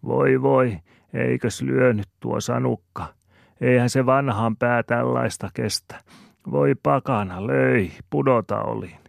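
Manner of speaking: high, loud moaning granny voice; wailing old granny. A narrator's reading. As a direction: lament and complain like an aggrieved grandma. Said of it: "Ja mummo voivottelee". (mummon voivottelua korkealla äänellä)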